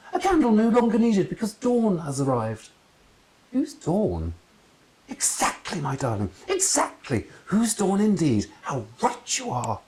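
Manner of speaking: a fake seer being profound; theatrical oracle voice; mystic aunt. Aunt philosophising grandly.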